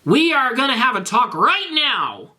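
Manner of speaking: high, sharp scolding voice; a furious girlfriend laying down the law; scolding Ebony. Acted. Ebony tells Garfield off, high scolding voice.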